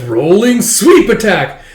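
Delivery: slow, loud, high mock attack cry; attack call. Hero bisecting a monster, drawn-out mock battle call. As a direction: call out the special move, hammed-up.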